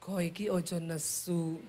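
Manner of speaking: low, soft, slow Javanese plea; calm her down in hushed Javanese; gentle Javanese husband. Retold or imitated. Husband begging his wife not to be cross, soft Javanese accent. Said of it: "Orang Solo kaget".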